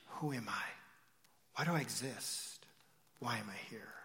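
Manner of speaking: low, hushed questions with pauses; soul searching. Acted. Troubled soul questioning its own existence, hushed. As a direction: ask softly, as if alone in despair.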